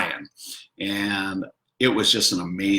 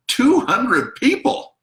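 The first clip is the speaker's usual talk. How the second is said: stretched-out, much higher exclaim; amazed; 13 semitones higher, 4 dB louder, slower than usual. Retold or imitated. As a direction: marvel at the huge crowd. Amazed at gondola crowd.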